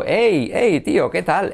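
high-pitched casual hail; calling a greeting. Acted. Greeting a buddy to show the vowel, hailing. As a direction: greet a mate with a lifted voice. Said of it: "como saludando".

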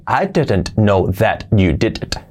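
slow, every t and d pronounced; word by word. Shown as a demonstration. Teacher shows how nadie habla así, word by word. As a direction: carefully separate each word, no linking. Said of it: "nadie habla así".

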